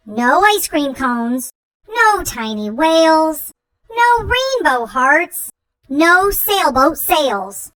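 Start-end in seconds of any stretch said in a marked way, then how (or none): none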